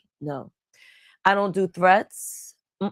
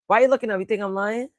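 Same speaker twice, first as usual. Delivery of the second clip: ordinary delivery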